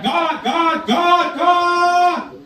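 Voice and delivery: high, drawn-out calls up to heaven; yelling out. Acted. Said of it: "started yelling out".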